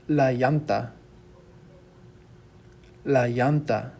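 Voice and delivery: "llanta" said as "YAHN-tah"; Y-sound double L. Shown as a demonstration. Teacher modelling Spanish word for tire, "llanta" with Y. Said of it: "makes sort of like a Y sound".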